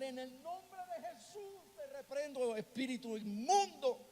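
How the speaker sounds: forceful yelled exorcism; shouted rebuke. Retold or imitated. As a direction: cast the unclean spirit out with a cry. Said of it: "y yo empecé a gritar".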